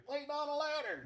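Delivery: high deadpan horse puppet. Acted. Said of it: "his voice is really close to my normal voice".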